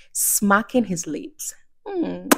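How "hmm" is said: evil chuckle. Acted. The wolf gloating over his plan, sly breathy snicker.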